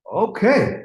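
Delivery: high, drawn-out "Okay" with a falling end; taken-aback okay. Retold or imitated. Producer hearing the singer's sudden orders, taken-aback okay. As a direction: mimic being amazed at her sudden orders. Retold reaction to sudden demands.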